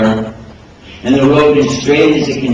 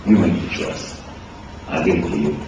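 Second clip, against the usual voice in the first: quiet surrender; 7 semitones lower, 9 dB quieter, faster than usual. Retold or imitated. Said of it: "you're asking Allah".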